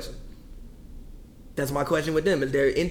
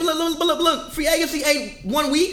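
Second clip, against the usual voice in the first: raised voice; 11 semitones higher, slower than usual. Real, not acted. Heated argument, raising voice.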